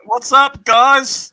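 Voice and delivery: high, loud youtuber hello; hammed-up greeting. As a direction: shout it like an overexcited streamer.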